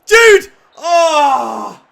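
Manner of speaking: loud, high yell then groan; real excitement; excited shout. Real, not acted. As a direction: scream at the chance and groan. Shout at a chance.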